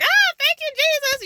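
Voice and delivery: high, loud cry of thanks; overjoyed. Real, not acted. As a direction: burst out thanking Jesus. Overjoyed relief.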